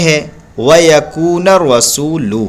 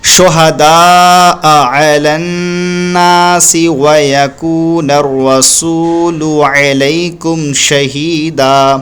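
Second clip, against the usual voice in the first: Quran recitation; much slower than usual. Shown as a demonstration. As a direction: chant the full verse slowly with long vowels. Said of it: "यूँ पढ़ा जाएगा".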